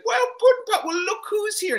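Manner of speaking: very high, loud mocking old man voice; Cosby impression. Comic mimicking Cosby greeting him on set, high and mocking. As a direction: squeal it out like a teasing old star.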